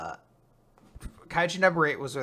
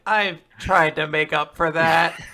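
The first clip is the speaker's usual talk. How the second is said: louder, drawn-out mock protest; acted protest; 3 semitones higher, 11 dB louder, slower than usual. Host retelling her attempt to make amends, mock loud protest. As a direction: draw it out slowly and loud, as a retold reply. Acting out the character's reply.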